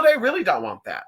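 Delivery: normal talking voice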